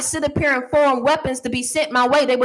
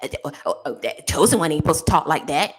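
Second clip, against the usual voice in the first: mocking critic; 9 semitones lower than usual.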